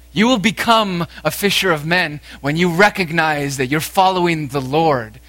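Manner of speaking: preaching loud and high, building fervor; impassioned preaching. Acted. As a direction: press the call home to the congregation. Preaching at full force on Jesus' call.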